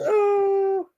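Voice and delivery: high, crying oh; mock sob. Mocking friend's sad story with a fake high sob. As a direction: fake a tiny sob of pity. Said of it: "everybody goes".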